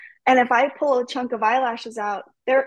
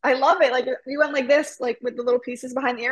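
ordinary delivery

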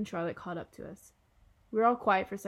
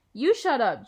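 loud, higher-pitched retort; yelling; 4 semitones higher, 9 dB louder, slower than usual. Acted. Julian fires back at Jack, yelling. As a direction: yell it straight back at him. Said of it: "Julian yelled".

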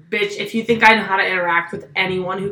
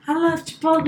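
put-on higher, softer little-kid voice; kid from vine; 6 semitones higher, 5 dB quieter than usual. Worker mimicking a viral clip, high kid voice. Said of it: "that little vine when you're, like, that kid".

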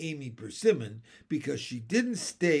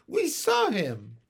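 high-pitched insistence; insistent protest; 7 semitones higher, 4 dB louder than usual. A narrator's reading. Michael standing by what he saw, insistent protest. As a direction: insist like a child disbelieved.

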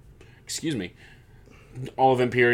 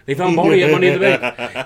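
louder, higher, fast hype call; excited announcer; 5 semitones higher, 7 dB louder, faster than usual. Shown as a demonstration. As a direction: shout it like a ring announcer. Announcing Riddle found again.

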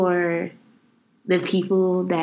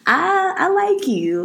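shy confession; 10 semitones higher, slower than usual. Retold or imitated. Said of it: "I'm like".

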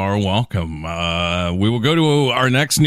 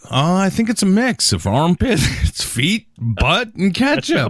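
mock disgusted sniffer; 7 semitones higher than usual. Acting out people noticing smell.

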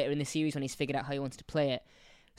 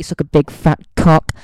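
a much louder joking jeer; mock taunt; 19 dB louder than usual. Mocking friend Katie over her least favourite Doctor, loud jeer. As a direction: yell it at her like a playground taunt.

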